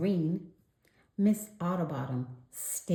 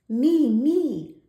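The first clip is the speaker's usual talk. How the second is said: loud high eager me me; eager cry; 9 semitones higher, 10 dB louder than usual. A narrator's reading. Polly begs to join story time, loud and eager. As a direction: pipe up eagerly. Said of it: "cried Polly Wogglina".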